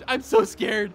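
ordinary talk

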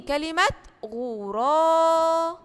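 غراب with the aa stretched slowly; drawn-out vowel. Shown as a demonstration. Teacher echoing a pupil's answer, slow and drawn out. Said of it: "ممتازين".

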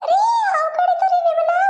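wife screaming as the snake reaches her husband; panicked scream. Acted.

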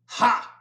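high, very loud barking laugh; loud scornful ha. A narrator's reading. Registrar scoffs at Will's answer, very loud. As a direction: bark one scornful syllable. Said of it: "real loud".

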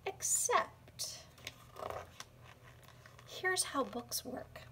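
hushed whisper, leaning in. Acted. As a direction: whisper it like a secret. Secret aside to the child.

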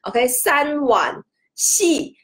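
三碗细 read very slowly, each syllable with its Cantonese tone; careful teacher read-through; slow demo reading. Shown as a demonstration. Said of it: "字面意思来是这样子读的哈".

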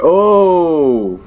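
high, drawn-out "oh" of fright; startled cry. Real, not acted. So scared player gets a fright, long startled cry. Jump scare in horror game.

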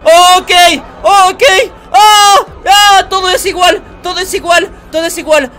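loud, high startled shouting; startled panic. Real, not acted. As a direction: yell out in alarm, pitch jumping up. Jump scare in horror game.

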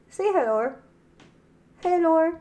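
high sing-song hello to the pet; high cooing pet talk. Real, not acted. Owner coos at her pet, high baby-talk hello. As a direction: coo a high hello at the little one.

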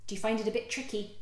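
plain reading voice

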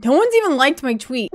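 ordinary talk

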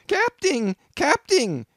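very high, loud frantic yell. A narrator's reading. Bumpkin yelling to the captain, high loud yell. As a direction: cry out for help at the top of the voice. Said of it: "yelled the bumpkin".

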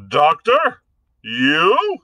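high, loud scoffing question; scornful disbelief. A narrator's reading. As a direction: scoff at the claim of being a doctor. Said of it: "rang in the heavy, hectoring voice".

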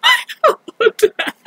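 high, loud sobbing wail; anguished crying. Real, not acted. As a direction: wail it out in sobs. Crying, breaking down again.

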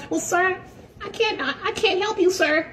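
very high, prissy mock voice; gym Karen voice. Karen asking for his last name, in a very high prissy voice. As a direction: squeak it like a fussy desk clerk.

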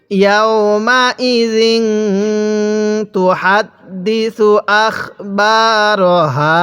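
very slow, drawn-out syllables; model recitation. Shown as a demonstration. Teacher models Quran words for learners, slow measured recitation. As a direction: stretch each long vowel evenly. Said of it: "এরকমভাবে পড়ব".

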